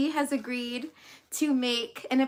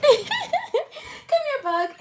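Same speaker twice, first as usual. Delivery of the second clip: high laughing call to the dog; high giggly pet call; 13 semitones higher, much slower than usual. Real, not acted. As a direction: giggle while calling her over.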